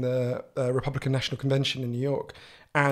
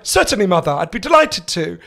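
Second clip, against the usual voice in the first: put-on loud, ludicrously posh child; Stewie from Family Guy; 3 semitones higher, 10 dB louder than usual. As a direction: answer with exaggerated plummy politeness. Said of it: "like fucking Stewie from Family Guy".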